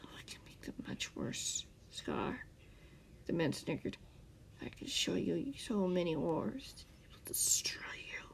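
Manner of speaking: whispered threat; menacing whisper. A narrator's reading. The man threatening Scar's dreams, whispered menace. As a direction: hiss the threat close.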